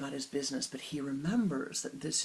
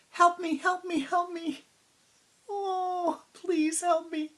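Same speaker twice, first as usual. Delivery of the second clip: much higher, louder panicked cry for help; child crying for help; 9 dB louder, slower than usual. Acted. Little girl frozen on the tower calls for help, terrified.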